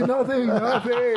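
high, slow, giggled denial; laughing it off. Real, not acted. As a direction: say it through laughter. Laughing while retelling denial.

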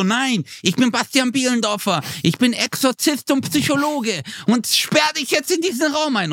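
pitched well up, grandly proclaiming; mock heroic exorcist. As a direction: play a pompous exorcist banishing a demon. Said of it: "Und du so". (hoch und feierlich wie ein Exorzist)

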